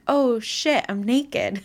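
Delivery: hammed-up surprise; mock shock. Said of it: "she was just like".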